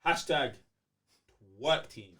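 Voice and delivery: ordinary talk, nothing marked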